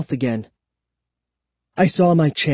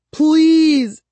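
high, loud, drawn-out plea; desperate begging; 13 semitones higher, 5 dB louder than usual. A narrator's reading. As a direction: beg at the top of your voice. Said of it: "I begged".

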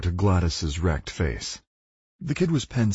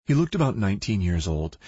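ordinary delivery, nothing marked

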